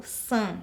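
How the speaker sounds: san said with a nasal a; nasal vowel on san. Shown as a demonstration. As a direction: let the a in san ring through the nose. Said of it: "com um som nasal".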